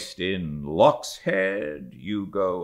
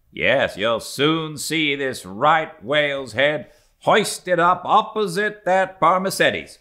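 Flask's voice; 8 semitones higher, 4 dB louder than usual. Acted. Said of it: "said Flask".

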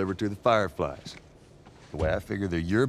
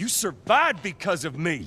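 higher, pushed yell of wounded pride; angry shout; 9 semitones higher than usual. Acted. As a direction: throw it in his face. Heated argument between brothers.

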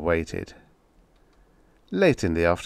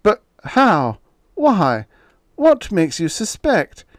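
much higher and louder, shrill panic; panicked shriek; 12 semitones higher, 7 dB louder, slower than usual. Acted. Exposed writer shrieking at his editor in panic. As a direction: scream in disbelief. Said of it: "Now his voice was a shriek".

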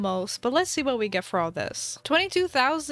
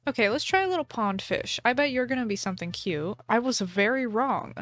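ordinary talk

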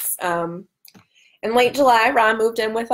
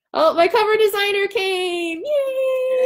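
high-pitched delighted greeting, much higher; delighted squeal; 11 semitones higher, slower than usual. Real, not acted. Streamer greets her cover designer joining the stream with a high, delighted squeal. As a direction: squeal with joy as a friend arrives.

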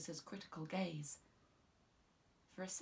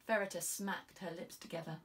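ordinary delivery

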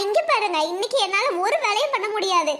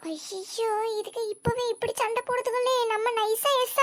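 quiet aside; 7 dB quieter than usual. Acted. Aside to audience.

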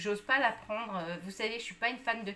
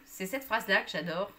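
ordinary talk, nothing marked

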